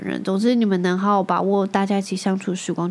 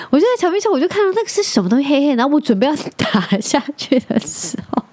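high, laughing, fast telling, higher; can barely tell it for laughing; cracking up while telling the booger story; 6 semitones higher than usual. Real, not acted.

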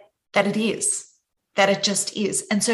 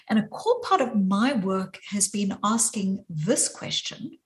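normal talking voice

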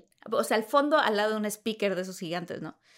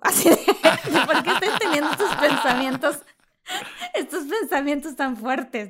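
laughing; 4 semitones higher, 5 dB louder than usual. Real, not acted. Laughing at her own thoughts.

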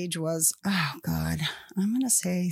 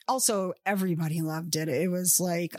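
ordinary talk

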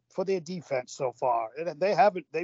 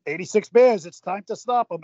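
retold yell; 4 semitones higher, 7 dB louder than usual. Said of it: "as John kept saying".

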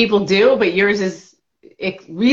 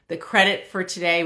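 ordinary delivery

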